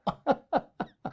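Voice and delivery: a short unvoiced laugh; airy chuckle; breathy laugh. Real, not acted. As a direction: laugh at the memory. Laughing at own story.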